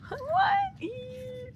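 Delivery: very high shrieked what, then a squeak; fangirl shriek. Real, not acted. Fan seeing the photo shrieks with delight.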